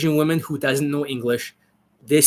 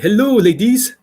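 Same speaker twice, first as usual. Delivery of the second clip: higher, louder mock African accent greeting the ladies; 6 semitones higher, 3 dB louder than usual. Coach mocking an African accent to a viewer, high and loud. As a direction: ham up an African accent, big and bright. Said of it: "Wakanda forever kind of accent".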